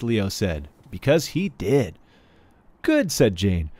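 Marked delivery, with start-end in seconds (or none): 2.8-3.1 put-on little girl. Jane voiced, high.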